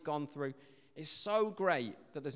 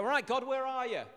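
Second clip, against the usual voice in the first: high, raised call to God; mock calling out; 8 semitones higher than usual. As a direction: call out as if searching for someone. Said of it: "want him to shout back".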